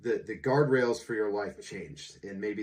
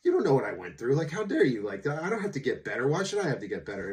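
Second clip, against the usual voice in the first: raised, indignant protest, a touch louder; defensive outrage, pitched up; indignant inner rant; 5 semitones higher, 5 dB louder, faster than usual. Retold or imitated. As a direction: voice the hurt pride of a man told to get better. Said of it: "sitting there in my head going".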